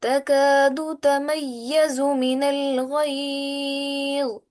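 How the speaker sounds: lovely slow recited verse for the class; Quran recitation. Shown as a demonstration. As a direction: chant each word long and tuneful. Teacher performs full tilawat.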